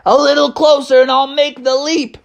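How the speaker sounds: high, tense call; urgent resolve. Acted. Bubby prepares to jump onto the truck, high urgent voice. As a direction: call it out high from the bus roof. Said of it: "and he said".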